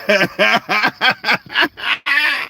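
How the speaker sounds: laugh. Real, not acted. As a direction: burst into a drawn-out laugh. Amused at keeping own secret back.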